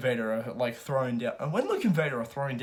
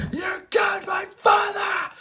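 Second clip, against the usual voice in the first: angry Luke; 6 dB louder, slower than usual. Retold or imitated. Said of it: "Luke's just all angry".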